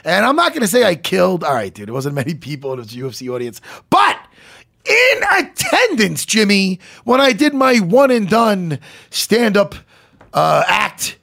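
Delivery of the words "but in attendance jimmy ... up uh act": high, loud build-up, stretching "in attendance"; hammed-up loud oratory; mock-grand proclamation. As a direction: proclaim it like a big reveal. Comic build-up of a bragging story.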